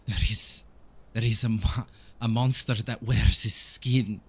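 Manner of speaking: put-on halting, accented priest in grief; grieving Donovich. Priest revealing his son is lost, grieving and halting. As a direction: push through broken phrases, sinking at the end. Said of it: "He says".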